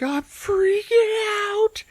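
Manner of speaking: very high, drawn-out wail; hammed-up high panic; mock freak-out. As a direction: stretch it into a panicked comic squeal. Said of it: "so he's like".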